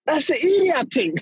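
lightly hammed-up Toronto street accent; Toronto mans accent. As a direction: put on a Toronto man's accent. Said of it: "You know, Toronto man, you know?".

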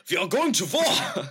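put-on loud, high German-accented shout; German war cry. Mock German soldier declaring war.

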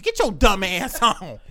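normal talking voice

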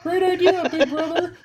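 very high, slow, cartoonish kid voice; little brother voice. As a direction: squeak it out like an overeager little kid. Little brother quoted, "that's what he said.

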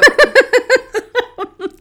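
tipsy high-pitched giggling; high giggle fit. Real, not acted. Tipsy laughter.

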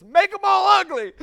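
loud laughing spite, high; laughing punchline. Acted. The laughing man tells God his wish, loud laughing. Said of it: "He says".

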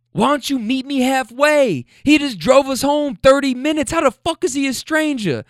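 high, exasperated hollering as the man; imitated arguing. Man defending the driver to his partner, imitated heated arguing. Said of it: "now they're screaming".

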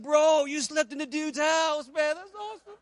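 very high, slow, drawn-out gushing; retold excitement. Convert impressed by the pastor's connection, retold as wildly excited. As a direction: imitate a man bursting with amazement, loud then trailing up high. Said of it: "That made him so excited".

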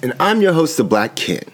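high-energy greeting. Real, not acted. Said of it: "came in high energy with that greeting".

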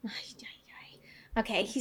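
soft voiceless murmur; whisper. Real, not acted. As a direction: whisper it while thinking hard. Whispering while trying to recall.

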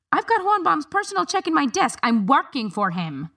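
high, heated protest; indignant. A narrator's reading. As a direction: protest hotly, pitch up. Harriet argues with guards.

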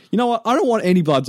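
high, loud retold outburst; mad refusal. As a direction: re-enact refusing the nurse, fed up. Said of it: "I was so mad".

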